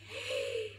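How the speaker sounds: sharp acted gasp; shocked gasp. Hippo realising who is to be eaten, shocked gasp. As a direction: suck in breath in horror. Hippo hears of two-legged prey.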